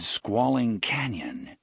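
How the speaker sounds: ordinary delivery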